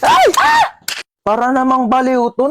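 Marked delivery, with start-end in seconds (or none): 0.0-0.6 high, sudden startled cry; startled awake in bed, a shrieking yelp. Acted. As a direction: jump out of your skin. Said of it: "Nagulat ako".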